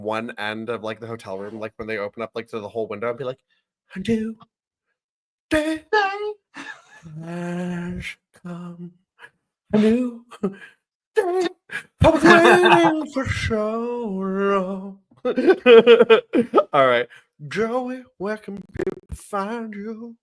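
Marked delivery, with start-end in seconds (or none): 4.0-15.0 high, slow, dramatic diva voice; [name] impression. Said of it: "one of my favorite to, uh, impersonate". 15.2-16.7 high burst of laughter; loud laugh. Real, not acted. Laughing after his impression.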